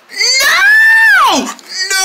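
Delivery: high screamed no, full volume; desperate scream. Acted. King screaming no at cancelling his speech. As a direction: scream it to stop them.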